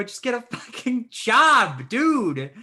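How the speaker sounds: high, loud, drawn-out exasperation; exasperated shout. Retold or imitated. Retelling advice to a jobless Uber driver, exasperated shout. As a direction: yell it slowly as retold advice at wit's end.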